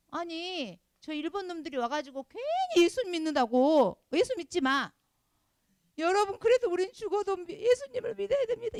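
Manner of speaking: high, loud, impassioned declaration; a martyr crying out his faith to the end; defiant martyr. Acted. Preacher voicing a martyr before Japanese persecutors, high impassioned cry.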